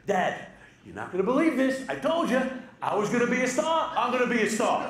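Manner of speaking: loud, thrilled bragging to dad; excited shout. Retold or imitated. Son shouting the big news down the phone to dad, thrilled. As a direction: yell it like you just made it big.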